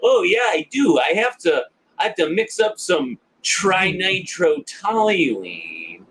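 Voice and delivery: high-pitched naive nerd voice; slow down on the long chemical word, voice high; Marty. Retold or imitated. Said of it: "they remind him".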